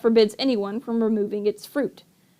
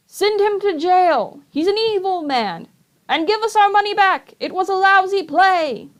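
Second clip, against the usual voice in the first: higher, jeering crowd shouts; angry crowd; 9 semitones higher than usual. Acted. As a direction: heckle from the seats. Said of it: "took up the cry".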